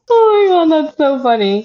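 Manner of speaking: ordinary delivery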